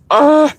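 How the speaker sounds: exasperated ugh; a short frustrated grunt of disbelief; inner groan. Retold or imitated. Voicing her silent reaction to the woman's answer, exasperated ugh. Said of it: "Inside, of course".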